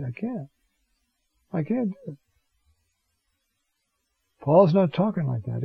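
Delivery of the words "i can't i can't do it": much softer, almost under the breath; quiet helpless admission. Acted. Doctor answering a dying patient who offers money, quiet helpless admission. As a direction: let the refusal drop away softly.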